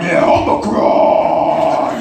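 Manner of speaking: hammed-up villain reveal; ominous mock voice. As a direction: make it sound like a monster. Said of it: "And then they're like".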